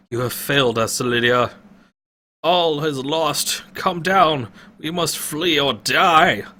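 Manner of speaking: put-on high, slow grim old man; old man ordering retreat; despairing old wizard. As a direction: give up the quest. Pentagon voiced, despairing.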